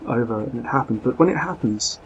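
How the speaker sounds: the lady insists, alarmed and forceful; urgent lady. Acted. Lady urgently warns of danger.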